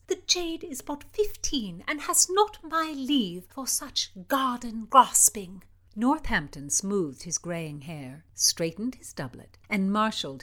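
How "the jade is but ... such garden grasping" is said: haughty princess. Acted. Princess rebukes the marquis.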